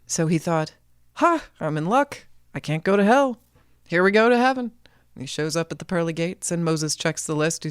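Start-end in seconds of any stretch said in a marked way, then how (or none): 1.1-4.7 higher, chirpy gloating; a cheeky trickster who thinks he's won; gleeful Jack. A narrator's reading. Jack gloating he dodged hell, higher gleeful voice.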